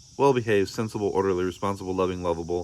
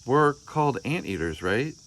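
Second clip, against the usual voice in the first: put-on child's voice; 5 semitones higher than usual. Child Arthur voiced in story.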